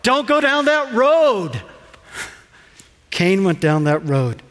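normal talking voice

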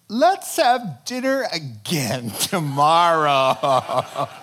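slow, loud laughing cop-out; sheepish dodge. Retold or imitated. Queen losing nerve before the king, laughing. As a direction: back out with a nervous laugh. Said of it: "And then she says".

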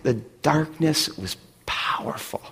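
slow, hushed whisper. Real, not acted. Pastor recalling Amsterdam's red light district, whispering slowly. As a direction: breathe it out slowly like a secret.

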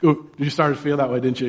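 ordinary talk, nothing marked

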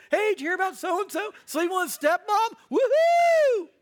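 high, fast mock churchgoer spreading scandal; gleeful gossip. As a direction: gossip excitedly, ending in a loud whoop.

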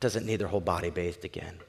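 low, soft correction; hushed, steady reassurance; patient Jesus. Acted. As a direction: explain it quietly and evenly. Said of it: "Jesus looks at him and says".

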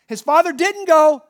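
ordinary talk, nothing marked

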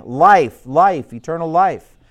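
loud cry of longing; fleeing man crying out for life. Acted.

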